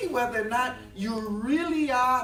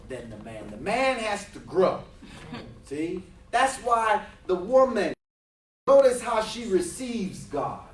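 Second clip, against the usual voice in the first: ordinary delivery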